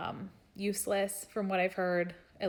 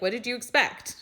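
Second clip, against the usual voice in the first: mean retort; 5 semitones higher, 6 dB louder than usual. Retold or imitated. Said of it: "And that's mean".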